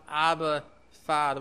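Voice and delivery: a soft, low, intimate "Abba, Father"; tender cry. Acted. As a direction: say "Abba, Father" softly, like calling daddy. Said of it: "which is a very tender".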